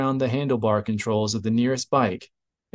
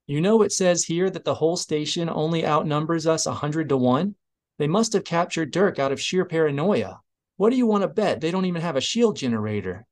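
higher-pitched, lively character voice; a touch of sarcastic banter, higher; lightly voiced Platt, sarcastic; 6 semitones higher than usual. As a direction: rattle off a wry complaint, pitch raised.

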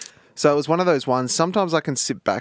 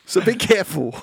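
mock warning; 8 semitones higher, 3 dB louder than usual. Real, not acted. Warning after the prank story.

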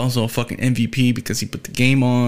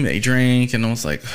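normal talking voice

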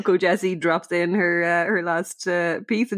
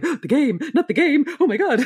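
mock crowd panic; 8 semitones higher than usual. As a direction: gasp it out like a horrified audience. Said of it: "the crowd are like".

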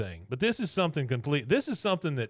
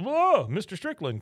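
mock Buck greeting; 8 semitones higher than usual. Buck greets Hank, retold scene.

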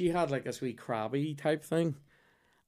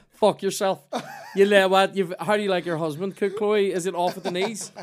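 loud, raised-pitch comic retort; mock outrage; 7 semitones higher, 9 dB louder than usual. Customer hitting back at the teasing waiter, mock-angry. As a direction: snap back in hammed-up indignation.

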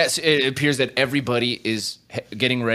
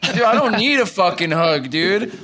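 put-on drunk man yelling; 12 semitones higher than usual. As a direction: scold him like an offended drunk. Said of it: "He's like".

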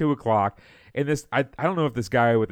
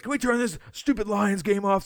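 imitating an annoyed fan, pitched up high; irritated fan demand; 10 semitones higher than usual. Acting out fan demanding channel change.

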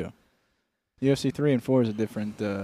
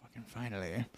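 very soft, low relieved murmur; mock whisper; 5 semitones lower, 17 dB quieter than usual. Old friend at the funeral, muttering softly. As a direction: breathe it out, hushed. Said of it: "and you're like".

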